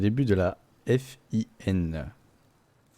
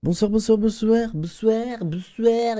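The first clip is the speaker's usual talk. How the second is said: weird guy greeting; 5 dB louder, slower than usual. Retold or imitated. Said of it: "il fait des cris bizarres".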